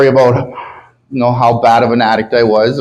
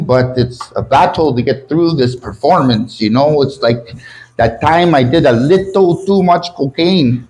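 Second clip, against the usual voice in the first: hammed-up French-accented English; halting mock-French open-mic delivery; fake French accent. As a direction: read it out with a put-on French accent. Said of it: "imagine I'm reading this in French".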